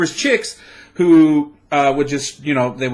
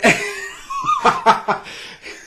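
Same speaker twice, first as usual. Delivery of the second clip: shrieking laugh. Real, not acted. Laughing at her American word.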